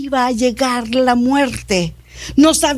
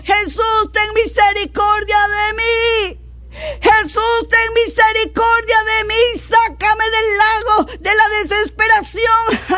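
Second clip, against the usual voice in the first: high, fervent plea for mercy; impassioned prayerful cry; acted crying out; 7 semitones higher than usual. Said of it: "no clamamos".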